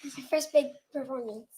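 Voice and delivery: quiet, shy. Real, not acted. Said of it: "Say it louder".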